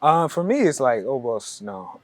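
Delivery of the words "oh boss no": Ghanaian accent demo. Shown as a demonstration. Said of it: "we just wanna hear your accent".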